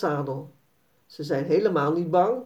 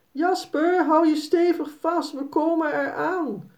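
mock shriek of a worried mother; 9 semitones higher than usual. Mother runs after her son's runaway pony, high worried cry. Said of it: "gilt Jaspers moeder".

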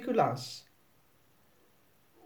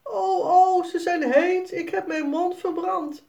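much higher, louder yelping Piet; Piet met verbrande mond; 11 semitones higher, 5 dB louder than usual. A narrator's reading. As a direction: yelp like a burnt mouth. Piet brandt mond aan pepernoot. (veel hoger en luider, jammerende Piet)

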